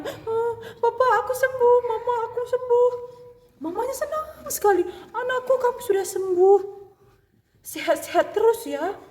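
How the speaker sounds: put-on very high, happy little girl voice; healed girl rejoices with her parents, very high; joyful child. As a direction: celebrate being well again.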